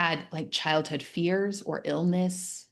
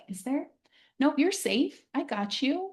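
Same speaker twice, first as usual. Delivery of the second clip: put-on higher, gentle reassurance to a kid; comforting a child; 7 semitones higher than usual. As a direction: check under the bed and reassure. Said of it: "comforting them".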